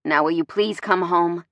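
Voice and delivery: ordinary delivery, nothing marked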